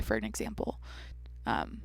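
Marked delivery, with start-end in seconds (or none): 1.5-1.8 creaky, breathy fry; vocal fry. Shown as a demonstration. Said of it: "how to do vocal fry".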